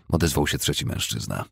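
ordinary delivery

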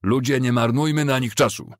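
rallying shout, moderately projected; Ambrose Bell. A narrator's reading. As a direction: call out to the men to move on. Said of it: "zawołał Ambrose Bell".

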